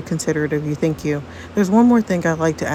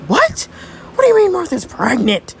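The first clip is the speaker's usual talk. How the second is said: shocked disbelief; 12 semitones higher than usual. Acted. Friend hears Frankie's news, high shocked outburst. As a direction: blurt it out in shock.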